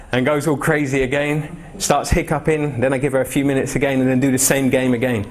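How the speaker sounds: ordinary talk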